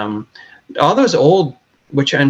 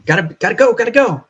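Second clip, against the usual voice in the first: frantic rush; 9 semitones higher, faster than usual. Retold or imitated. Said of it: "it's like the world".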